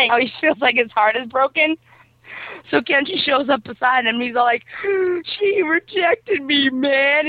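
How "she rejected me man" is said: high, slow, drawn-out wail; mock crying. Bully tells Kenji he was rejected, mock crying. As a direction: blubber like a heartbroken bully, hammed-up. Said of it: "is crying".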